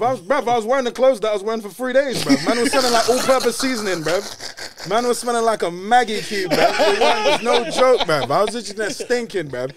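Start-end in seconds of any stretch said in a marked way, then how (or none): 2.5-9.7 laughing. Real, not acted. Laughing telling of smelly clothes.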